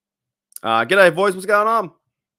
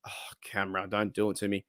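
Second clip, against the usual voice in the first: whispered plea; 5 semitones lower, 10 dB quieter than usual. Real, not acted. Camera failing, quiet plea.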